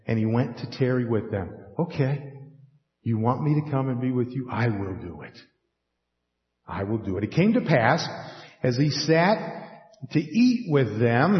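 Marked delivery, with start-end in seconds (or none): 1.8-7.2 softer, tender agreeing voice; Jesus quietly accepting the invitation; gentle Jesus. Acted. Jesus to the two disciples, soft and tender.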